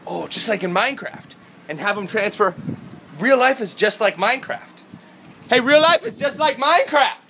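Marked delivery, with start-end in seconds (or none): 3.2-4.8 raised, louder, dawning excitement; excited realisation. Acted. Vlogger realises real life resembles the game, excited realisation. 5.5-7.2 very high, loud yell held throughout; screaming. Acted. Vlogger yells his Minecraft discovery from hotel, screaming. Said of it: "screaming about Minecraft".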